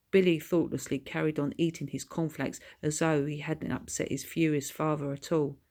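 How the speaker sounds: plain reading voice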